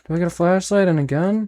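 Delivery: usual speaking voice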